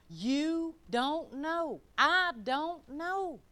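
"You don't know. I don't know." stretched very slowly, word by word; drawn-out emphasis. Real, not acted. Preacher drives home not judging others, "You don't know. I don't know." slow and emphatic. Hammering home the sermon's point.

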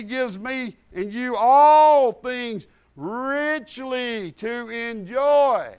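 very slow, drawn-out stress on enjoy; slow emphasis. Acted. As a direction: savour every word of the promise. Preacher stretches out promise.

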